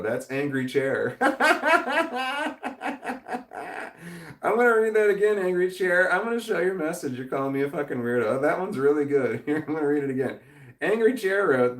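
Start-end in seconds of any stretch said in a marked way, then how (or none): 1.2-4.3 long, high-pitched laughing fit. Real, not acted. Streamer just read an insult from Angry Chair, cracking up. 4.4-7.2 high, loud, amused after a laughing fit; laughing delivery. Real, not acted. Streamer relishing an insulting message, high and amused.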